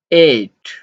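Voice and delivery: usual speaking voice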